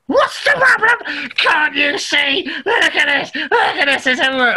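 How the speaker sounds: high, shouting and crying out; angry shout. Retold or imitated. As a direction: yell it in outraged disbelief. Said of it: "the guy's like".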